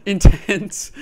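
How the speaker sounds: high, loud word through real laughter; helpless loud laugh; laughing hard. Real, not acted. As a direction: crack up and get the word out. Cracks up after game scare.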